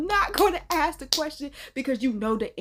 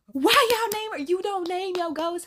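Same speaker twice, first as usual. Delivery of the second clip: exasperated; 6 semitones higher than usual. Acted. Scolding the storyteller's friends, high incredulous question. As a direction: throw the question up high in disbelief. Repeats question, second far higher.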